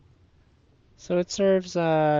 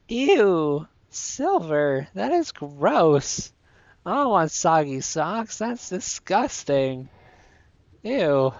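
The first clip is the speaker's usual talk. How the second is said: grossed-out disgust; 5 dB louder, slower than usual. Real, not acted. To Silver about soggy socks, loud grossed-out disgust. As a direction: recoil from something gross.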